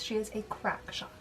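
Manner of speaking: breathy conspiratorial whisper; whispered aside. Acted. Vlogger praising a tough heroine, whispered aside. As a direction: share it like a delicious secret. Admiring aside about character.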